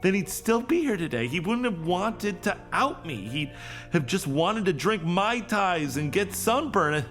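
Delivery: high-pitched, upset self-reproach; distraught confession. Acted. Employee blames herself for what happened to him, distraught. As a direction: pour out guilt, voice pitched well up. Confesses guilt over colleague's death.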